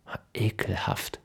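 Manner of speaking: leise geflüstert, voller Ekel; angewidertes Flüstern. Acted. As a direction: hauch den Ekel nur hin. Ekel über das Eis. (angewidert geflüstert)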